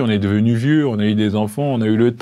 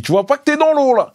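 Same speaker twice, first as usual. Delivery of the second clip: high, funny movie-line voice; high-pitched mimicked film quote; Raphaël Quenard impression; 4 dB louder than usual. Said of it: "si c'est la voix".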